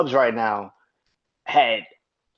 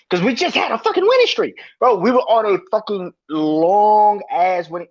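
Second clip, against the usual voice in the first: worked-up rant; 7 semitones higher, 5 dB louder than usual. Real, not acted. Fan ranting about lost streak.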